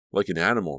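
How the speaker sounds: ordinary delivery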